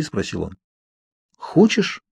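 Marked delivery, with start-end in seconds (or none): 1.4-2.0 a sudden high, surprised question. A narrator's reading. Bella asks Edward back in surprise, surprised. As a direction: Bella caught off guard, surprised. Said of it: "удивилась я".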